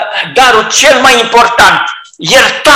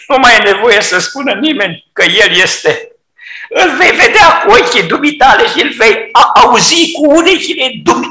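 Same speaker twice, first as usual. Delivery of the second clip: ordinary talk, nothing marked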